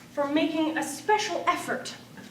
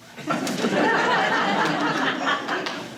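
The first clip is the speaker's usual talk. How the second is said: breathy laugh; 8 semitones higher than usual. Real, not acted. Laughs at own poem.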